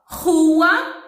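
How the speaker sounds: "Rua" with a throaty R, loud and high; strong initial R. Shown as a demonstration. Teacher gives an example, "Rua" with strong R. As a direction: push out "Rua" with a guttural R, pitch rising. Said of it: "começa com um R forte".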